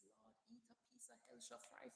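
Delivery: hushed, breathy whisper of longing. Real, not acted. Whispering to a viewer flying home to Jamaica, craving fried fish.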